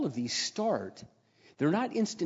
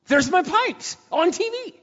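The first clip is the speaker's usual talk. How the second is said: much higher, louder, slow; stunned discovery on TV; astonished; 10 dB louder, slower than usual. Acted. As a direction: gasp it out in amazement. Seeing pipes in commercial.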